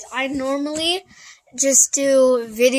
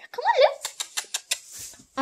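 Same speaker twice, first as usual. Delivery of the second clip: high coaxing call; 4 dB quieter, much slower than usual. Real, not acted. Calling her pony over.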